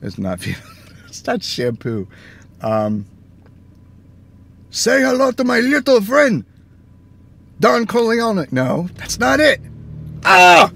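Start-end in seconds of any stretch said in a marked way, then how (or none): 4.7-8.5 hint of a put-on movie gangster voice; bad Scarface impression. Trying a movie gangster impression, lightly voiced. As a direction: toss off a half-hearted gangster quote. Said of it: "my horrible impression".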